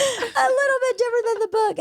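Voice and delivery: very high, squeaky through laughter; laughing. Real, not acted. Agreeing with book club friends, laughing through the words. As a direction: echo it while cracking up.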